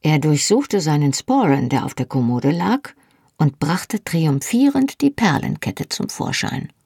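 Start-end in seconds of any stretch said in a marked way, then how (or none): none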